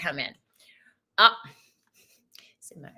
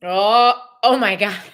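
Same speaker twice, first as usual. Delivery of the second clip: a loud, laughing oh my God; laughing surprise; 3 semitones lower, 6 dB louder than usual. Real, not acted. Reader shocked by the card, laughing out loud. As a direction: burst out laughing in disbelief.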